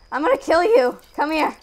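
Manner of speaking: high, loud playful threat; shouted threat. Real, not acted. Player threatening a game enemy, high loud shout. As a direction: yell it at a fleeing enemy.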